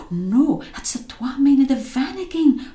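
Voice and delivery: high panicked shout; scream. A narrator's reading. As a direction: yell it out in alarm. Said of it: "Robbie screamed".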